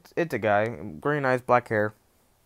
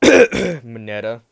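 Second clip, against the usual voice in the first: name hidden in a cough; 10 dB louder, slower than usual. Pretend. Teller mocks the pervert by coughing out Mineta. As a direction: fake cough with Mineta blurted, slow and loud. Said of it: "he says Mineta out loud".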